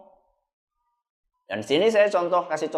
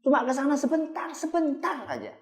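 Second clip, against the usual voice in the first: higher, softer sweet-talking father; coaxing father; 8 semitones higher, 5 dB quieter than usual. Acted. Father coaxing his crying child before leaving, higher and soft. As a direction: soothe the child with a fib, light and high. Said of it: "dia berbohong kepada anaknya".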